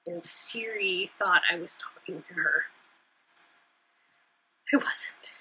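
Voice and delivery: hushed whisper, half to herself; whispered aside. Real, not acted. Aside about Siri interrupting.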